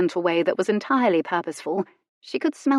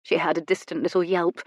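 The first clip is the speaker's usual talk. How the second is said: plain reading voice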